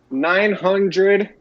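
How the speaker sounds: ordinary talk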